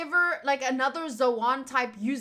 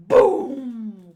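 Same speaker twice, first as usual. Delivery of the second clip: loud, low boom; boom sound effect; 3 semitones lower, 10 dB louder than usual. Acted. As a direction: blast it out like an explosion.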